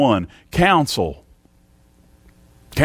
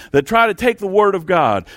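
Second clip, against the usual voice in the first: "try" leaned on, a little higher and held; stress on try; 4 semitones higher than usual. Shown as a demonstration. Preacher urging his congregation, stress on "try". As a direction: lean into "try" so the church hears it. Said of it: "and I emphasis on try".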